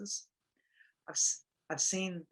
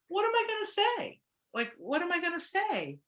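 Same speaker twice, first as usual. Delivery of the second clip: flustered; 13 semitones higher, 3 dB louder than usual. Retold or imitated. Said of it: "I was like".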